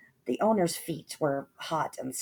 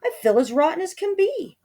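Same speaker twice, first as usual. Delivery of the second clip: high sulky complaint; moaning Cindy; 11 semitones higher, 5 dB louder than usual.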